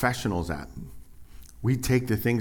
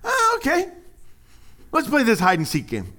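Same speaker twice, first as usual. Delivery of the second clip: much higher, louder sing-song play; a parent teasing a hiding toddler, playful; 6 dB louder than usual. Retold or imitated. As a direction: play along with the hiding child. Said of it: "You're playing along".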